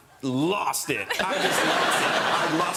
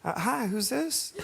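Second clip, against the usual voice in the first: [name]'s voice; 8 semitones higher, slower than usual. Retold or imitated. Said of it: "And I heard".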